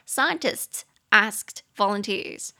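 极慢地念 Scientists asked volunteers; 拆开句子逐词慢念; very slow model reading. Shown as a demonstration. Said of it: "and later asked other people to smell it". (老师分段慢读示范)

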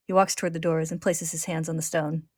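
ordinary talk

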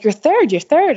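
high, loud retold cheer, you're third; calling out the result with delight; excited cheering. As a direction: friend cheering at the finish line, thrilled and loud. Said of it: "Tina was going bananas".

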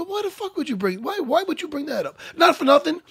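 retold exasperated demand, pitched high; indignant outburst. Fighter telling off a tactless ref, indignant and high-pitched. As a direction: repeat the question in high-pitched disbelief. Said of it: "I said it just like that".

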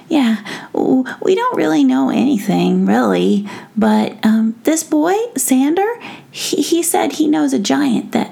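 put-on nervous young gnome; gnome boy voice. Tom answers the prince.

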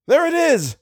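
high-pitched cry of alarm; excited shout. A narrator's reading. Algernon spots the monster ahead, shouting. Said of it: "shouted Algernon".

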